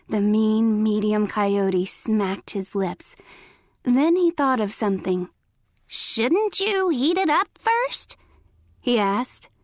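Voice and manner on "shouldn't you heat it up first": high, slow fake granny voice; high-pitched granny imitation. A narrator's reading. Coyote questioning Little Red about chili, fake granny voice. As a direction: pitch it up and take it slowly. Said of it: "in a high-pitched voice".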